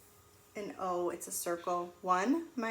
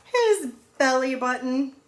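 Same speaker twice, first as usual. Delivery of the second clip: loud, bright punchline reveal; excited reveal; 3 semitones higher, 11 dB louder than usual. Acted. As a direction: announce the surprise answer to the kids.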